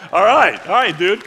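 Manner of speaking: loud exclaiming; excited cheer. Real, not acted. Preacher cheers Phil's juggling trick, loudly excited.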